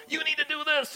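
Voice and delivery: very high, strained mock urging; urging impulse, acted. Body's urges voiced.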